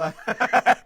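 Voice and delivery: a sudden burst of loud, high laughter. Real, not acted. Host reading viewer comments bursts into a loud laugh. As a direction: crack up out loud at the name.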